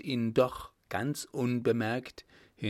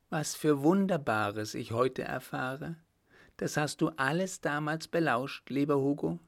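higher woman's voice, full of wonder; Margot, marvelling; 4 semitones higher than usual. Acted. Woman learning of her beloved's secret, marvelling. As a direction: marvel lightly in a raised heroine's voice.

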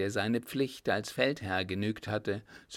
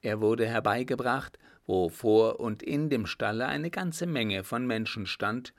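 ordinary delivery, nothing marked

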